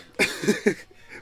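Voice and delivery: high, breath-heavy burst of laughter; amused laugh. Real, not acted. Listener reacts to friend saying people be lying, amused laugh.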